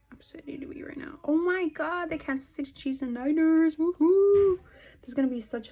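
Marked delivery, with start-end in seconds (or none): 0.1-1.2 whisper. Real, not acted. 1.2-4.6 higher and louder excited cheer; thrilled football fan whoop. Real, not acted.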